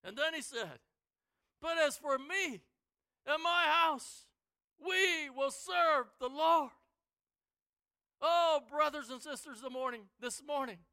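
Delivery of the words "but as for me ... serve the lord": loud, slow, weighty; resolute declaration. Retold or imitated. Joshua declares his household's loyalty, slow and loud. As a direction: plant every word firmly.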